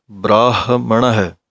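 ਬ੍ਰਾਹਮਣ slowly with a clear ਹ; measured ਬ੍ਰਾਹਮਣ ਹੈ; correct pronunciation. Shown as a demonstration. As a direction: correct ਹ demo. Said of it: "ਇਹ ਸਹੀ ਉਚਾਰਨ ਹੋਵੇਗਾ".

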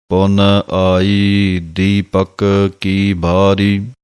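slow chanted gurbani line; slow devotional chant; slow recitation. Shown as a demonstration. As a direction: intone each phrase slowly, lilting at the end. Recites scripture slowly, sung ending.

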